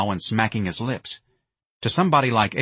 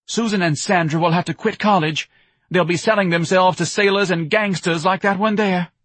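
high, loud lament; distraught wife ranting; 10 semitones higher, 5 dB louder than usual. A narrator's reading. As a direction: rail about the family's ruin. Wife rants at her husband.

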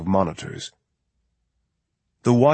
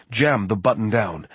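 ordinary delivery

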